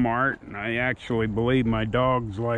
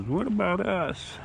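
higher, small pleading dog voice; little dog voice; 6 semitones higher, 4 dB quieter than usual. Pretend. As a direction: speak for the little dog, asking to be included. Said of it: "The little dog says".

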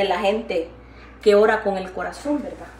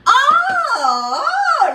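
mock surprised oh; 14 semitones higher, 5 dB louder than usual. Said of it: "le dije yo".

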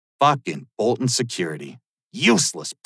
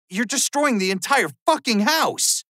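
much higher, strained indignant yell; outraged protest; 10 semitones higher than usual. Acted. Shouting at gunmen wrecking his house, outraged high protest.

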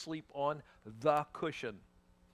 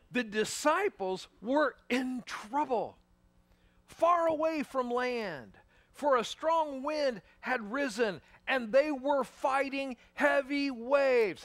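slow, higher, building reading of the storm; dramatic scripture reading; 8 semitones higher, 4 dB louder, slower than usual. Acted. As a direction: read it slowly like a suspense tale.

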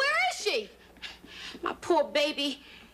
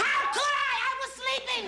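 indignant shout; 11 semitones higher than usual. Acted. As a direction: yell it, stung by the blame. Woken late, indignant protest.

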